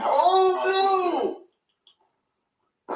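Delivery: loud, high cry of despair; anguished yell. Real, not acted. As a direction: howl as the goal goes in. Goal conceded, cry.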